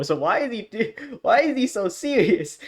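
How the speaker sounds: high-pitched disbelief; amused incredulity. Real, not acted. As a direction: ask it high, baffled by his effort. Incredulous at the hero's seriousness.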